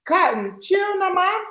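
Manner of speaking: shrill-high mock Jamaican accent; high, loud Jamaican put-on; high-pitched Jamaican patois. Shown as a demonstration. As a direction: do a squeaky, over-the-top Jamaican patois. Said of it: "Say it like the actual Jamaican way".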